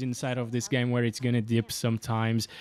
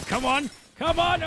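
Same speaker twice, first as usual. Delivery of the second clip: urgent come on; 11 semitones higher than usual. Real, not acted. Urging during a gunfight.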